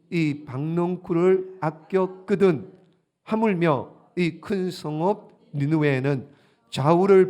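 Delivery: slow, loud, measured reading; solemn scripture reading. Acted. Pastor reading God's words to the congregation, slow and loud. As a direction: read the Lord's words slowly and loudly.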